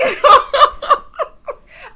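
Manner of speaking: high, loud peal of laughter. Real, not acted. Host cracks up at Kathy's after party question, loud laugh. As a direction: burst out laughing, big and high.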